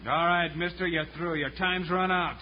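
normal talking voice

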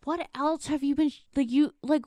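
put-on high, loud angry demand from the boyfriend. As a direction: boyfriend defending his girlfriend, rising to a shout. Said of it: "And then he was like".

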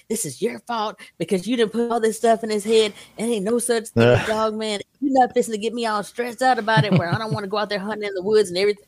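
hammed-up husband scolding her; husband fussing. Husband scolding his wife over the dog man stories, mock fussing. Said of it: "my husband was fussing at me".

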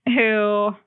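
ordinary talk, nothing marked

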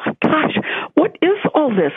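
high-pitched retold alarm; freaking out. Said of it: "I was freaking out".

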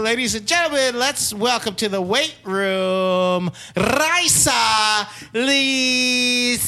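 announcer intro with rolled R; grand slow ring announcer. Acted. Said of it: "with the rolling R's that I got really excited".

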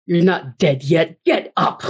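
slow, measured command from an unseen voice; imitated voice from nowhere. Retelling the voice she heard answer her, slow imitated command. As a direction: imitate a commanding voice, each word spaced out. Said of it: "a voice like that tell you to get up".

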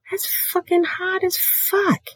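high breathy squeal; high excited squeaky remark; squeaky gush. Real, not acted. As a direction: gush at the top of your voice. Excited emphasis.

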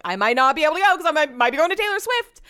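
high, fast, giddy retelling; bubbling over with big news; excited. Retold or imitated. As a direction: rush it out, pitch climbing to the end. Retells telling friend her big news.

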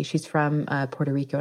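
normal talking voice

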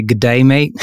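playful mock Australian greeting; hammed-up Australian twang on the greeting; Australian accent. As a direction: throw on a cheeky Aussie accent. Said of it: "you're definitely half Australian with a".

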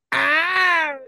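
high drawn-out mock moan. High hammed-up moan a wife might give in bed. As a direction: moan it over the top. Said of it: "I'd be like".

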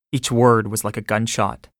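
ordinary delivery

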